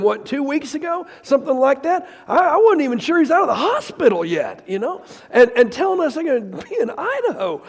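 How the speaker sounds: loud, high, impassioned preacher; fired-up sermon, ringing with emotion; full-force preaching. Acted. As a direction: preach it out to the whole room.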